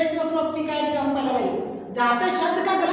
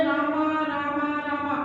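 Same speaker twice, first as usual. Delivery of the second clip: repeated name in a slow, even chant; powtarzane skandowanie; slower than usual. Shown as a demonstration. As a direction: chant it over on one level note. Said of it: "अब हम बोले".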